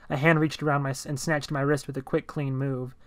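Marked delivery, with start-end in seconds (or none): none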